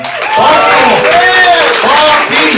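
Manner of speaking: crowd-pumping announcer yell; hype shout. Real, not acted. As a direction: call the comic up like a hype man. Host hyping next comic.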